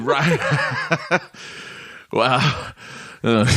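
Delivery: high laughing reaction. Real, not acted. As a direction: laugh through the words. Laughing at the long email.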